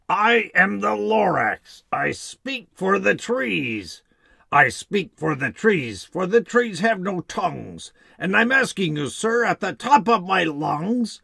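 sharpish bossy Lorax. Acted. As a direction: speak up for the trees like a bossy old forest spirit. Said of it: "he spoke with a voice that was sharpish and bossy".

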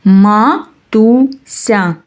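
very slow: Ма-ту-ся; teacher stretching the word for children; syllable by syllable. Shown as a demonstration. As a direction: sound out "Матуся" piece by piece. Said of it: "щоб було три?".

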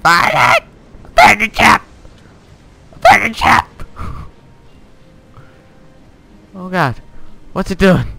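loud, high panicked shouts; panicked yelling. Real, not acted. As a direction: scream in alarm as the ship sinks. Ship crashing, panicked yelling.

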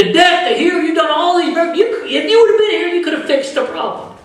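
high, fast, accusing complaint with a half laugh; reproachful mourners. Retold or imitated. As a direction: rattle off the blame at Jesus. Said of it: "that's what people were getting".

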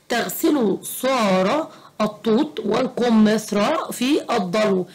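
heavy emphatic consonants in الطوط, القمثرى and الضلو; misspelled reading. Shown as a demonstration. Teacher reading out a sentence with mistakes, heavy wrong consonants in الطوط والقمثرى. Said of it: "الجملة هنا مكتوبة فيها حاجات غلط".

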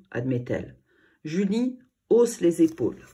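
plain reading voice

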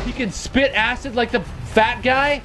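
ordinary talk, nothing marked

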